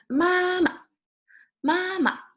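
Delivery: "Mama" high, then a short, quick last syllable; "Mama" with a toneless, fast second syllable; neutral tone ending. Shown as a demonstration. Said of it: "you stop it very fast".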